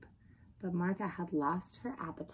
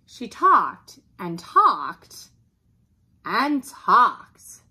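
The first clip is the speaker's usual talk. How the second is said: each "talked" punched loud and drawn out; weary emphasis; 3 semitones higher, 14 dB louder, much slower than usual. A narrator's reading. Narrator on the dog's endless chatter, "talked" hit hard. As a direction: lean slowly and hard on every "talked".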